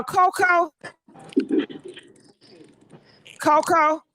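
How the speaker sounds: high questioning call to a caller. Real, not acted. Host tries the next caller on the line, calling out high. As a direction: call the name up high, waiting for an answer.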